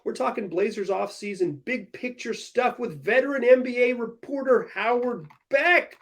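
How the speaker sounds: upbeat podcast intro announcer; show intro read. Acted. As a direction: announce the episode brightly.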